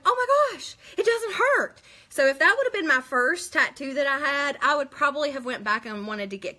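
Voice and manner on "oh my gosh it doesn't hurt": much higher and louder, delighted surprise; thrilled gasp of relief, replayed; retold excitement. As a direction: squeal the relief like it's happening again. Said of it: "I was so excited".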